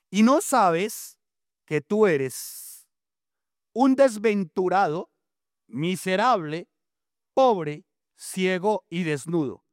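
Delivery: preaching. Acted. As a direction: drive the rebuke home to the church. Preacher declaims the rebuke. (reproche predicado, lento y elevado)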